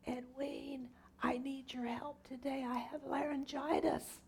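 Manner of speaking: high, soft, strained squeak with almost no voice; squeaky little voice. Retold or imitated. Minister phoning for help with laryngitis, squeaky little voice. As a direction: imitate a laryngitis croak, high and weak. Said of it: "in this squeaky little voice".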